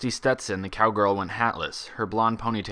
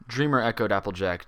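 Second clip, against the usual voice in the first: usual speaking voice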